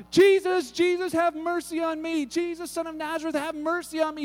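high-pitched calling out, loud at first then easing; imitated desperate yelling. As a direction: act out a blind beggar crying out for mercy. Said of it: "yelling his head off".

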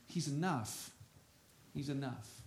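falling to a hushed, fading repeat; soft echo. Real, not acted. Preacher to congregation, repeating softly for weight.

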